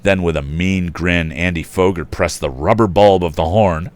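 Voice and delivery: loud, animated storybook reading; story narration. A narrator's reading. Narrator reading to listeners, animated and loud. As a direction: tell the tale with gusto.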